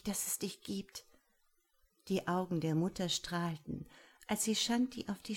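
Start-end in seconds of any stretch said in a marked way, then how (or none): 0.0-1.1 tender whisper to mama. Acted. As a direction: breathe it softly. Tender end of hug.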